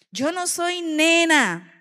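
put-on high, slow and indignant, like a small girl; a child insisting she is not a baby; child's proud protest, lightly voiced; offended little girl.

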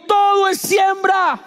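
slow, high shout of the slogan; slow shouted rally cry. Acted. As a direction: belt it out with the crowd. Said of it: "Alguien grite conmigo".

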